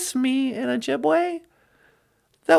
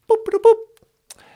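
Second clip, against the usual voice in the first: higher slow mock beeping; computer beeps; 5 semitones higher than usual. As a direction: beep like a ship console.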